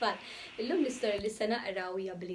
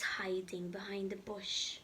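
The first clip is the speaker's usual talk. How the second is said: whisper; 4 semitones lower, 5 dB quieter than usual. Real, not acted. Reading to the kids, she whispers the question. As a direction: whisper it like a secret.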